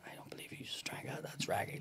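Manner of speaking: stunned near-whisper; hushed disbelief. Retold or imitated. Host stunned by the guest's drinking, hushed whisper. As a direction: drop to an awed whisper at what you saw. Host's stunned reaction, retold.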